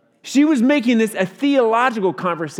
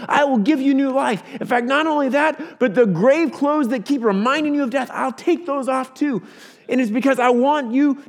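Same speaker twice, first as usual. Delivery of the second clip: higher-pitched, emphatic pulpit appeal; impassioned preaching; 6 semitones higher, 4 dB louder than usual. Acted. Preacher voicing Jesus calling listeners out of the tomb, impassioned preaching.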